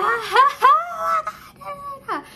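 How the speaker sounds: very high, loud hammed-up ha ha ha; big fake laugh. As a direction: belt out an over-the-top laugh. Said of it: "I do this big ass laugh".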